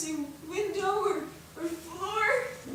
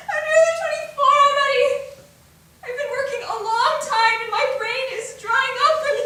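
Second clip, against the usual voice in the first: high, loud, sobbing lament; crying; 7 semitones higher, 9 dB louder than usual. Acted. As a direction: pour it out in tears, louder and louder. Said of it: "Oh, don't cry".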